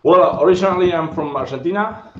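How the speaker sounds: ordinary talk, nothing marked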